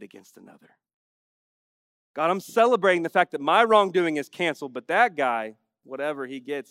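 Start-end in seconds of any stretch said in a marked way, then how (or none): 2.1-6.7 much louder, higher hammed-up smug prayer; mock self-righteous prayer. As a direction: pray loudly, dismissing that guy. Acted hypocritical prayer.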